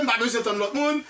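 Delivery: ordinary delivery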